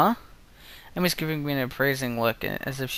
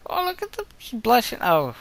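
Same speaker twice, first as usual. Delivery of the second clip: excited gushing; 11 semitones higher than usual. Real, not acted. Player gushing at the blushing art, excited. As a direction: gush like a fan who just saw her favourite blush.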